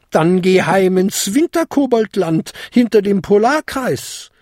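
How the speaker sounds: put-on high, imperious old lady; commanding. A narrator's reading. Old lady sending goblin home, commanding. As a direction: order him home firmly. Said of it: "befahl die Patentante der Feenkönigin".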